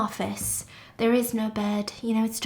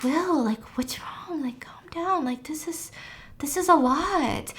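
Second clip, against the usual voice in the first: soft, half-whispered, higher voice; hushed calming; 6 semitones higher, 4 dB quieter than usual. Retold or imitated. The guests tell Will to calm down, hushed and cautious. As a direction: try to calm someone down under your breath.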